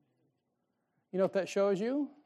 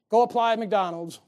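mock boss order; 3 semitones higher, 8 dB louder than usual. Mock boss voice telling baby to work.

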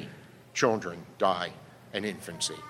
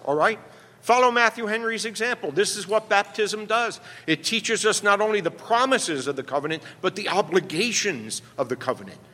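ordinary talk, nothing marked

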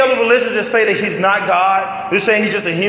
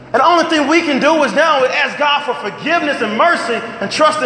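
raised, pushing preacher's voice; fiery preaching; 5 semitones higher than usual. Acted. Pastor preaching the cross to his congregation, fired up. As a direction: preach it out with full force.

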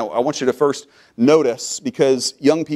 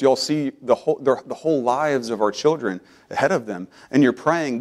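ordinary delivery

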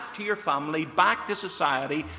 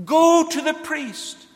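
loud, slow, incredulous repeat of the order; exclaimed command; 8 semitones higher, 8 dB louder than usual. Retold or imitated. Preacher to congregation, mocking how foolish the order seems, loud and slow. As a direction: throw the command out as if it were absurd.

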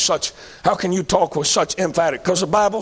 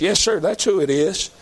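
normal talking voice

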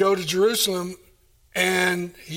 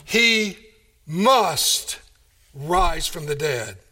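MUST set apart by pauses; slow it down, isolating HE and MUST; emphatic, word by word; 3 semitones lower, much slower than usual. Real, not acted. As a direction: land on MUST, then let "rise from the dead" fall. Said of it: "I want to emphasize that fact".

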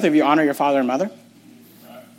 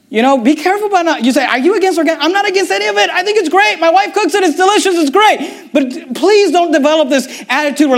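higher, rising preacher's push, faster; fired-up preaching; 7 semitones higher than usual. Acted. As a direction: preach it hard to the congregation. Preacher building to full force.

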